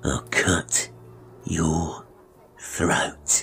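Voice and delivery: halting, sinister whisper; whispered threat. Acted. Barber repeating his warning to the boy, menacing whisper. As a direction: whisper it word by word, with long pauses.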